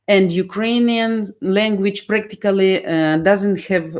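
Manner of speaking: ordinary delivery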